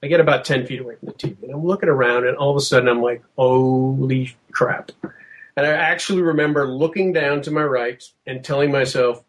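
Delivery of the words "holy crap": slow, drawn-out awe; stunned realization. Retold or imitated. Man spots a figure on the tape, stunned slow reaction. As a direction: stretch it out as it dawns on you. Retold moment of stunned discovery.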